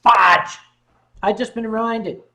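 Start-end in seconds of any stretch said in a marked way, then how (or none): 0.0-0.6 sudden loud outburst; loud exclamation. Real, not acted. Streamer suddenly remembers mom's forgotten jacket, loud outburst. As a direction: blurt it out loudly in dismay.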